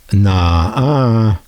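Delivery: teasing, sing-song denial; drawn-out playful no-no; playful nah-uh. Retold or imitated. Collector teasing viewers about the radios, playful nah-uh. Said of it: "as we used to say".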